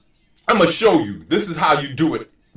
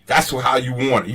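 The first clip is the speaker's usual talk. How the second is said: ordinary talk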